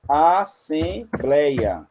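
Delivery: ASSEMBLEIA very slowly, syllable by syllable; sílabas destacadas. Shown as a demonstration. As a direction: break "assembleia" into long syllables. Shows syllables of example word. (assembleia pausado, sílaba por sílaba)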